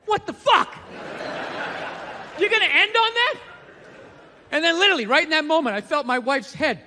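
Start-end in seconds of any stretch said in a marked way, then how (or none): 0.1-3.3 pitched up, appalled disbelief; what the hell, you end there; stunned outrage. Retold or imitated. Disbelieving the video just ended, outraged. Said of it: "I was sitting there like".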